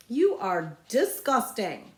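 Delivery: slow, loud disgust. Acted. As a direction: spit out the disgust. Girl disgusted at slurp.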